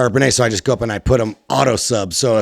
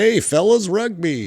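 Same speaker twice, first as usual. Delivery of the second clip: mock Australian accent; 7 semitones higher than usual. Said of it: "my non-Australian voice".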